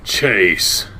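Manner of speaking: low, terse warning. Acted. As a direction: clip the name as a warning. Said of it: "His tone is terse".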